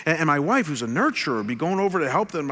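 ordinary delivery

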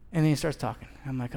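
usual speaking voice

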